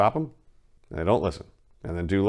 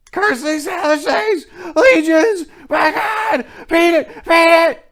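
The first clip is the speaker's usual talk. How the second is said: much higher, loud desperate shriek; 12 dB louder than usual. A narrator's reading. Trapped man shrieking a final warning to his friend, terrified. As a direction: scream in utter horror. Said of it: "gradually rose to a shriek".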